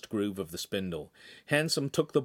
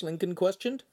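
ordinary delivery, nothing marked

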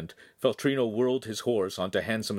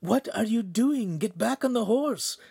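high-pitched alarmed cry; alarmed companion's high cry; urgent cry; 12 semitones higher than usual. A narrator's reading. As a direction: cry out in a much higher voice, urging him back. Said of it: "Pan cried into Handsome's ear".

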